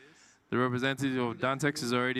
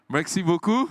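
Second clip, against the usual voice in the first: "Merci beaucoup" called out loud and higher, French vowels; playful French thanks; 6 semitones higher, 7 dB louder than usual. Pretend. Host jokes he speaks French, loud playful thanks. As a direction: show off French, loudly. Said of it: "I know how to speak French".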